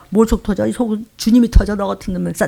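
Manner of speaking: normal talking voice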